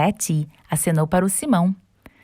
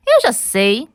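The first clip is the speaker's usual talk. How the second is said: excited shout; 5 semitones higher, 5 dB louder, slower than usual. Acted. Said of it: "gritou o Ted".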